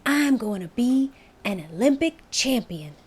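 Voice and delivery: put-on high, proud boy's announcement; announcing proudly. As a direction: declare it like a big promise. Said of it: "and announced".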